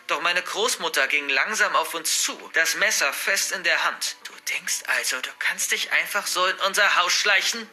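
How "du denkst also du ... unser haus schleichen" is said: ordinary delivery, nothing marked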